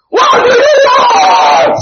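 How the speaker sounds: very high, long-stretched shout; crying out. Shown as a demonstration. Pastor showing how to read the demon's words, shouted. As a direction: scream it out slowly as an extreme cry. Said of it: "that's the way I can understand that scripture".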